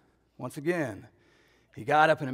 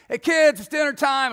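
put-on much higher and louder, calling kids to dinner; 11 semitones higher, 10 dB louder than usual. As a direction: call it up the stairs.

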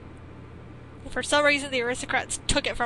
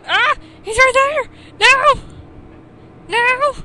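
loud, much higher panicked yells; panicked shouts; 10 semitones higher, 10 dB louder, slower than usual. Real, not acted. Player spots the boy getting away, panicked yelling. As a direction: shout in alarm as he spots him.

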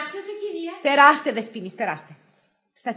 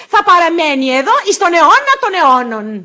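slow, loud, raised intoning; mock liturgical; 5 semitones higher, 9 dB louder than usual. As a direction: proclaim it like the end of a prayer. Mock liturgy, answered with Amen. (στομφώδης ψαλμωδία)

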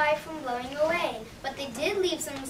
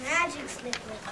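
upbeat commercial voice selling slippers; ad read; 3 dB louder than usual. Acted. Shoe store ad selling slippers to listeners, upbeat pitch. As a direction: pitch it like a radio spot.

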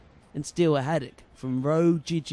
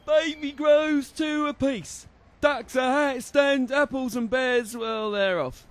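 cockney market trader; 10 semitones higher, slower than usual. Acted. Market trader calling out to passing shoppers, cockney cry. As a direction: hawk the fish like a cockney stall owner.

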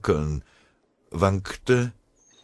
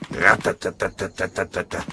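machine gun imitation; 3 semitones higher, 4 dB louder than usual. A narrator's reading.

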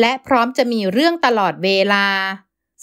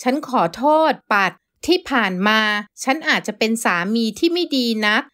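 ordinary delivery, nothing marked

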